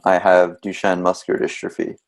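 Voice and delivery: ordinary talk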